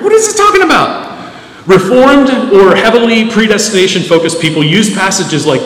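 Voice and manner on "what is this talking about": high puzzled question from the pews; mock the confused listener, much higher. Pastor voicing a confused congregant, high puzzled. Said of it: "some people are wondering".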